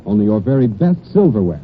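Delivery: ordinary talk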